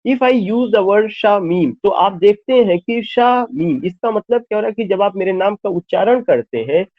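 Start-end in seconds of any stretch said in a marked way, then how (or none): 1.1-1.7 Shamim split as "sha, meem"; syllable break. Shown as a demonstration. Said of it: "sound शा पे जाके रुकता है". 3.0-3.8 Shamim split as "sha, meem"; Shamim with a gap after "sha"; syllable break. Shown as a demonstration. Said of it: "sound शा पे जाके रुकता है".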